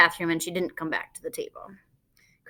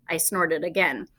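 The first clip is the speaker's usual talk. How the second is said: usual speaking voice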